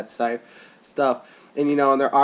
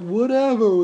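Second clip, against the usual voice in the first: mock dismissive girl; 10 semitones higher, 5 dB louder, slower than usual. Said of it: "it's like".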